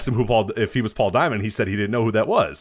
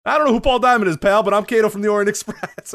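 high, loud, hyped wrestler-style driver; limo driver voice; 9 semitones higher, 5 dB louder than usual. Retold or imitated. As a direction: ham up the driver's eager agreement. Driver insists he's Kato.

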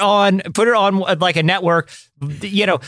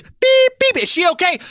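imitated TV bleeps; 10 semitones higher than usual. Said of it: "And all you hear is".

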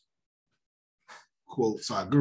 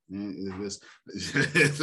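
ordinary talk

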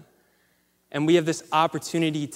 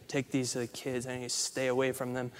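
quiet curt order; 4 semitones lower, 10 dB quieter than usual. Retold or imitated. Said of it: "and he's like".

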